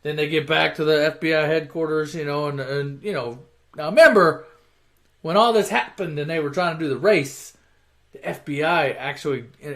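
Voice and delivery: ordinary delivery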